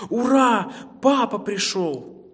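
high, loud cheer, drawn out; excited cheer. A narrator's reading. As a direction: cheer like little bunnies greeting their father. Bunnies jump up as father returns.

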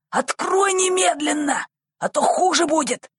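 high-pitched, angry demand; angry threat. A narrator's reading. Thief threatening the boy to open at once, angrily. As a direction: threaten with mounting impatience, pitched well up. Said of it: "прерывающийся от злости голос".

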